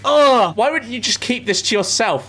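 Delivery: loud groan of disgust. Real, not acted. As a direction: recoil in revulsion. Disgust at scabies.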